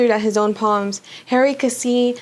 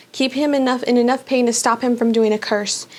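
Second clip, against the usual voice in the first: usual speaking voice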